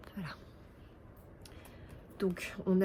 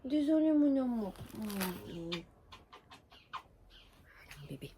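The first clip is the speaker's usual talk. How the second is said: cooing to dog; 4 dB quieter than usual. Real, not acted. Apologises to dog again.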